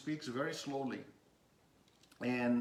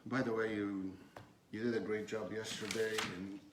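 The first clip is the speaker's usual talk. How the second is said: low, soft and offhand, no warmth in "great job"; flat half-hearted praise; 6 semitones lower, 4 dB quieter than usual. Shown as a demonstration. Manager praising team member without meaning it, mumbled and offhand. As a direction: mumble the thanks like you barely care. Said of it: "if I do it this way".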